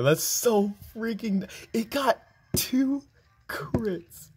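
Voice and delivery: high, slow, drawn-out complaint; exasperated disbelief. Real, not acted. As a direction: stretch the words in incredulous protest. Complaining about two crits.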